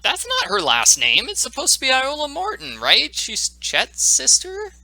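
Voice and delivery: puzzled outrage rising; baffled surprise. Real, not acted. Confused by changed character name.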